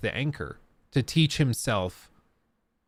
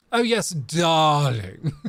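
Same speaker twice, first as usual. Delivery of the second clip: slow, louder mock posh British accent; 4 semitones higher, 6 dB louder, much slower than usual. Joking about precise speech, slow mock British accent. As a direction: ham up a posh British darling. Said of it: "pretend to be British".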